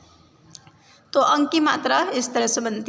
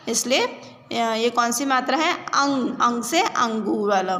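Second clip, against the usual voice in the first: usual speaking voice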